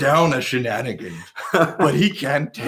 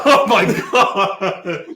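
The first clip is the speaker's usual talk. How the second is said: laughing; 4 semitones higher, slower than usual. Real, not acted. Laughing in disbelief.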